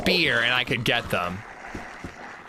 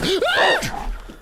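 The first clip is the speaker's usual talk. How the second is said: sudden gasp of fright; sharp high gasp. Real, not acted. Sudden wolf attack.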